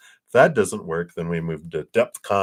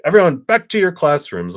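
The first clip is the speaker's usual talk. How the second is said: loud, high announcement to a crowd; calling out orders; 11 semitones higher, 8 dB louder than usual. Retold or imitated. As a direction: send the whole school back to class. Retold order to assembled students.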